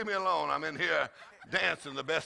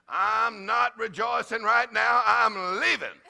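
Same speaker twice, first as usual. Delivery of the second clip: retold huffy protest, slow and raised; mock stubborn refusal; 6 semitones higher, 8 dB louder, slower than usual. Husband retelling how he refused his wife's call to rejoice, mock stubborn refusal. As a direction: act out digging your heels in.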